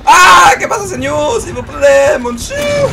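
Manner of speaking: high, loud pleading in mock Spanish and French; over-the-top foreign cries of alarm; mock panicked shout. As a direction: shriek for mercy, hammed up. Mock foreign pleading under attack.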